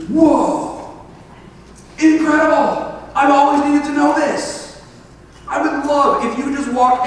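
high-pitched acted amazement; amazed excitement. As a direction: exclaim it as if stunned by wonderful news. Acts out ideal response to gospel.

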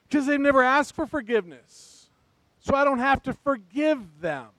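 full-force pulpit preaching; fired-up preaching. Acted. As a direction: preach it out to the whole church.

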